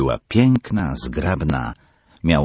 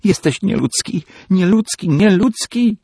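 shrieking higher and louder each time; screamed; 6 dB louder than usual. Acted. Peggy screams at her father, rising each time. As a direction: scream it, building to hysteria. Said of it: "odskoczyła ... i krzyknęła".

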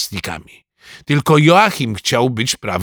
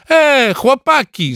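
high, drawn-out call; 14 semitones higher, 4 dB louder, slower than usual. A narrator's reading. Goalkeeper calling to the boys from his goal, drawn-out shout. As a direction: call across the pitch, stretching the words. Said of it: "zawołał Alces ze swojej bramki".

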